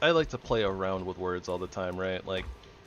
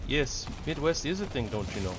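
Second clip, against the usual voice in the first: a light, self-admittedly bad Midwest accent, higher at the start; mock Midwest accent; 6 semitones higher than usual. Streamer jokingly imitating a Midwest accent for chat, lightly done. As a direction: tease chat with a half-hearted Minnesotan "don't you know". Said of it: "my Midwest impersonation is probably pretty bad".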